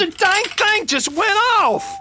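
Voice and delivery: high, startled, defensive cry; panicked excuse. Acted. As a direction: blurt it out in panic. Gun went off accidentally.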